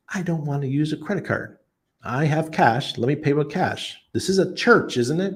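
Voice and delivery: mock protest, pitched high; indignant protest. Visitor arguing with church lady over cash, high-pitched protest.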